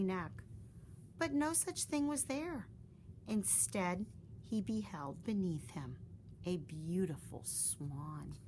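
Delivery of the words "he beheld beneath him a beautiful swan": usual speaking voice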